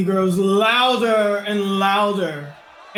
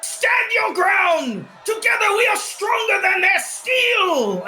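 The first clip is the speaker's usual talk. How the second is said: higher fiery agitator voice; rabble-rouser; 9 semitones higher than usual. Acted. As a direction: whip the crowd up against the crown. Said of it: "And then another one goes".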